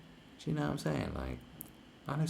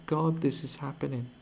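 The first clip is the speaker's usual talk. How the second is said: much higher, gushing delight; mock rapture; 10 semitones higher than usual. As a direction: swoon with overblown joy. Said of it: "It was like".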